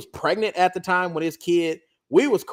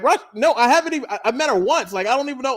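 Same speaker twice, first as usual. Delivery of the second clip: higher, louder, protesting fast; raised flustered denial; 6 semitones higher, 3 dB louder than usual. Retold or imitated. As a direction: deny it, rattled and raised. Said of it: "I'm like".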